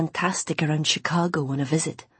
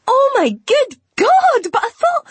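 much higher, louder, slow shriek; screaming with joy; 10 dB louder, slower than usual. Acted. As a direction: shriek it out in disbelief. Jenny screaming with excitement.

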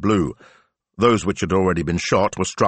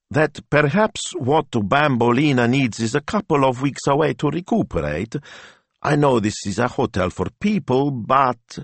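higher, tentative asking voice; hesitant Signor Rossi; 8 semitones higher than usual. A narrator's reading. Signor Rossi hinting that the hotel might take his dog, hesitant. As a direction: edge toward an awkward favour. Rossi making a shy request.

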